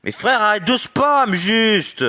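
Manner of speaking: high, loud exasperated shout; exasperated yell. Real, not acted. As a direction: yell at him to stop. Yelling at spamming opponent.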